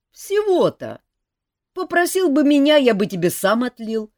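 шут Жак. A narrator's reading. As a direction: wave it away lightly. Жак отмахивается. (небрежный шут, выше и громче)

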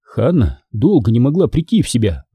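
usual speaking voice

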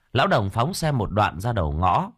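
usual speaking voice

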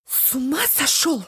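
furious high shout; berserk rage. A narrator's reading. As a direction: scream it in a rage. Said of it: "в бешенстве закричала мама".

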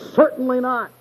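high-pitched, emphatic "Certainly not"; emphatic denial. Shown as a demonstration. Preacher quoting the first denial to the congregation, emphatic. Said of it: "The first emphatic denial is".

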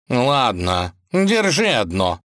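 grudging, drawn-out giving in; reluctant. A narrator's reading. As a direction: give in unwillingly, pitch rising. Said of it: "неохотно сказал кот".

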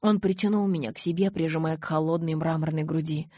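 usual speaking voice

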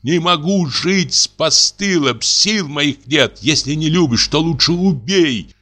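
high, slow, trembling plea of a broken wife; high slow desperate sobbing plea; tearful pleading. A narrator's reading. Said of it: "трясётся, плачет".

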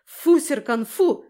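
loud, high, sharp dog command; forceful shouted scolding; barked command. A narrator's reading. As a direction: bark the order at your dog. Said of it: "рявкнула я".

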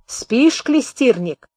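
ordinary delivery

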